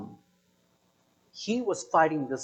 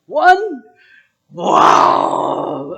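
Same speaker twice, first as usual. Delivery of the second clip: high incredulous question into a big shout; loudly stunned; loud disbelief; 7 semitones higher, 12 dB louder than usual. Real, not acted. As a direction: exclaim in exaggerated astonishment. Disbelief at only one hand raised.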